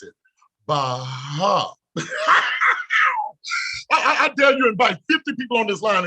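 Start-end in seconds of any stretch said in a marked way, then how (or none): 2.0-3.9 very high, piercing laugh; shrieking laugh. Real, not acted. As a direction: let out a wild, squealing cackle. Wild laugh after the punchline.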